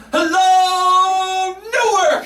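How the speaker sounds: Oprah greeting crowd. Retold or imitated. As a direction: belt it to the arena like a talk-show star. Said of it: "there's Oprah the first night".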